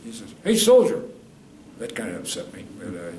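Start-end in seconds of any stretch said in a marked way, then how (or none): 0.4-1.0 loud high call across the station. Retold or imitated. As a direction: hail a passing soldier loudly. Man calling out in station.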